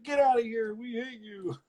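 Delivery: high jeering townsfolk voice; playful crowd imitation; mock jeering crowd. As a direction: shoo him off like an angry mob. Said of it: "They're like".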